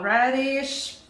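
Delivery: normal talking voice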